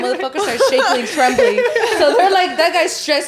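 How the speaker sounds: loud, high laughing. Real, not acted. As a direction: laugh through the words. Laughs while telling.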